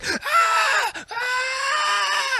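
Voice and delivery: long, piercingly high screams; hysterical screaming. Acted. Comedian points the neighbor to his computer, screaming hysterically. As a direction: scream instead of answering, hammed up. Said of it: "I'm in there screaming".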